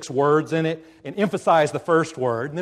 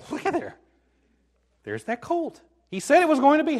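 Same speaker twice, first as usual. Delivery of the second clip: amazed disciples; 11 semitones higher, faster than usual. Acted. Disciples spotting the promised colt, high amazed chatter. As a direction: nudge a friend in amazement, pitched right up.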